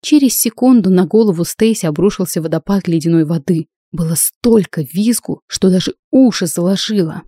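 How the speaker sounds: plain reading voice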